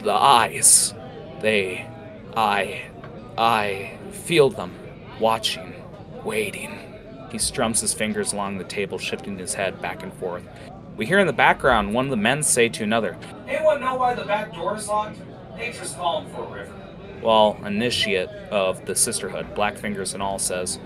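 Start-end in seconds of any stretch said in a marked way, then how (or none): 0.0-6.8 paranoid orc. Acted. 13.5-16.7 background man. Acted.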